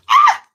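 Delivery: a sharp, very high, loud yelp of pain; sudden yelp of pain. Real, not acted. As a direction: yelp as hot ash lands on you. Burned by palo santo dip.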